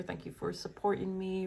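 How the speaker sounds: normal talking voice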